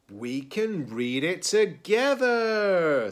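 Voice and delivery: high, loud, drawn-out delight; excited. A narrator's reading. Piggy proposes reading together with Gerald, excitedly. Piggy has a happy idea.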